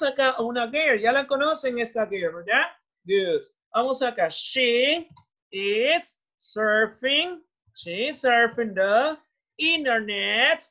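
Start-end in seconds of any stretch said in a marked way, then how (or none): 4.3-10.6 drawn-out, word by word; very slow model sentence. Shown as a demonstration. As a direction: stretch each word for the students. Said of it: "teacher models sentence slowly". (dictado muy lento)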